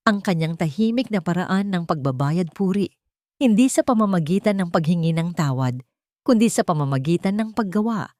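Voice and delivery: ordinary delivery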